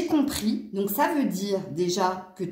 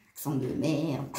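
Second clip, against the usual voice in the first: put-on Franche-Comté accent; 8 semitones lower, 5 dB quieter than usual. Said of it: "s'il parle comme ça en Franche-Comté".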